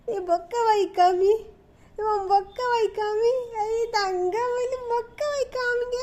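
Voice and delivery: high, soft sing-song. Real, not acted. Coaxing baby to show toothless mouth. (உயர்ந்த மென்குரலில் குழந்தையைக் கொஞ்சுதல்)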